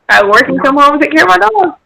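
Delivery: normal talking voice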